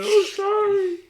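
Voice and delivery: loud, very high wail of sorry; mock tearful apology. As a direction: plead like a kid in trouble, high and loud. Punished kid apologizes in story.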